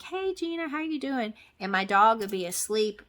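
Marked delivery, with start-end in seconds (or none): 0.0-1.3 cheery vet greeting. Retold or imitated.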